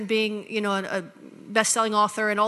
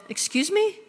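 a high, stunned "Excuse me?"; a sharp incredulous question; shocked disbelief; 9 semitones higher than usual. Retold or imitated. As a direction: act floored by the news. Shocked at being replaced.

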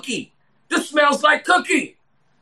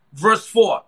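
ordinary talk, nothing marked

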